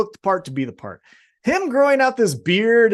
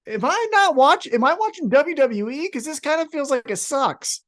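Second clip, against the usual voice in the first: much higher, incredulous complaint; exasperated disbelief; 8 semitones higher than usual. Retold or imitated. Host retelling his own reaction, high incredulous whine. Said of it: "I was like".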